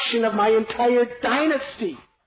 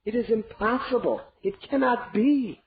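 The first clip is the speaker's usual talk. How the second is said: much higher, desperate pleading; begging; 9 semitones higher than usual. Acted. King at queen's feet, begging desperately. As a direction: beg her on your knees. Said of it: "he begged her".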